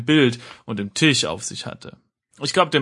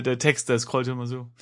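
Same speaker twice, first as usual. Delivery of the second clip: usual speaking voice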